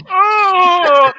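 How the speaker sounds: loud high yelp of burning; pained cry. Retold or imitated. Running to the bar, loud high pained cry. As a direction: cry out with a mouth on fire.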